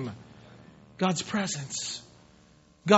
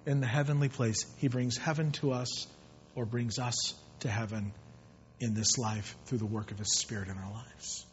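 low, soft aside; hushed explanation; 5 semitones lower, 8 dB quieter than usual. Real, not acted. Preacher explaining heavenly blessing, hushed and low. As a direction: drop right down in pitch and volume.

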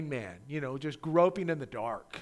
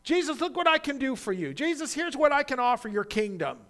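mock boasting; 10 semitones higher, 6 dB louder than usual. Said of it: "He isn't like".

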